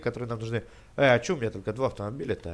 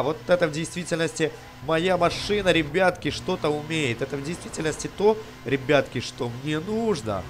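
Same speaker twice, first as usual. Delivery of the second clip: ordinary talk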